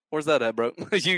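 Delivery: normal talking voice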